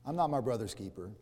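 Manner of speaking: brushing it off, low and muttered; half-acted dismissive shrug; dismissive denial. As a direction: shrug off any blame for the brother. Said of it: "He's denying responsibility".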